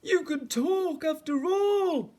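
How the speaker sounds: high, loud, slow exclamation; astonished cry. A narrator's reading. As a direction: cry it out in drawn-out amazement. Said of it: "he cried".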